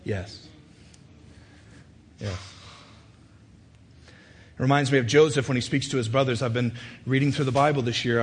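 ordinary talk

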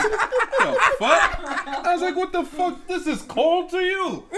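put-on high loud shouted Russian accent. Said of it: "terrible Russian accent".

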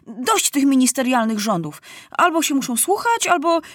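high-pitched, firm declaration; resolute ultimatum. A narrator's reading. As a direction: lay down the law as boy king. Maciuś resolves to bring order.

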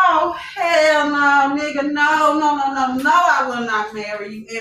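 slow, drawn-out mock refusal; emphatic refusal. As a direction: stretch out a scornful hell no. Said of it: "I said".

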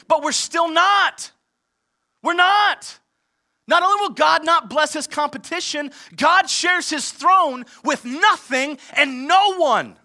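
loud, climactic declaration of faith; preaching shout. Real, not acted. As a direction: shout it out to the congregation.

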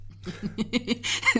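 short nervous giggle; nervous laugh. Real, not acted. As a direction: laugh off the nerves. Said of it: "c'est un rire nerveux".

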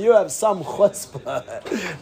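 slow retort dissolving into laughter; amused disbelief. Retold or imitated. Coach to the clock-pointer, laughing disbelief. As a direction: imitate a man chuckling at someone's nerve. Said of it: "He laughs like the guy's".

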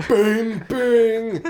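high, ringing bing; imitated big bell. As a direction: clang it out like a huge bell. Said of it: "it's a really big bell".

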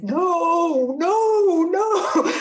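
playful inner refusal, pitched high; inner protest. Retold or imitated. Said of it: "inside, I said".

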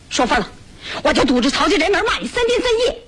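high-pitched yell, climbing to the end; yelled threat. Acted. Woman threatening to curse him at his employer's gate, yelling. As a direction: threaten him, building to a piercing finish. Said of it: "你别嚷，成不成？".

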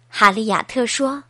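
plain reading voice

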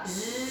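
long held soft buzz; long bee buzz. Shown as a demonstration. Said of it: "sama bunyi lebah".

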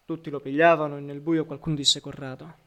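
plain reading voice

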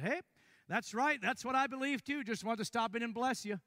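cheery backpedal. Retold or imitated. Visitor suddenly backs down, cheery.

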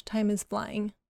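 ordinary delivery

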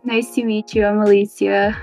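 normal talking voice

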